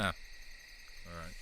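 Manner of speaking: usual speaking voice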